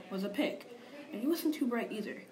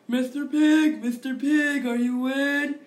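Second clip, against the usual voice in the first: loud, higher call through the door; 4 semitones higher, 11 dB louder, slower than usual. Retold or imitated. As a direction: call out to the pig in a loud sing-song. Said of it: "I called".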